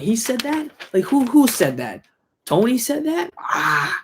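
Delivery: high, disbelieving squeak of a question; incredulous mock gangster. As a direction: play it like he can't believe his ears. Incredulous mob boss quoted.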